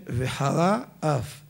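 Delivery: very slow וחרה, gap, then אף; words kept apart. Shown as a demonstration. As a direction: draw out וחרה and start אף separately. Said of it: "צריכים להבדיל בין". (וחרה אף לאט, בהפרדה בין המילים)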